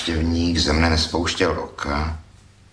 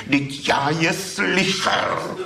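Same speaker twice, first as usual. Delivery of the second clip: slow, high and defensive, dragging each word; the thief defends himself to the poet, slow and high; 12 semitones higher, much slower than usual. A narrator's reading. Slow high defensive protest. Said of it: "bránil se hlas".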